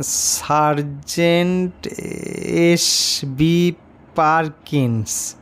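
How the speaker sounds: very slow, stumbling syllable by syllable; slow halting reading. A narrator's reading. Class four boy reading the medal aloud, slowly and haltingly. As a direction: read it out like a struggling child. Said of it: "অতি কষ্টে ধীরে ধীরে পড়লে".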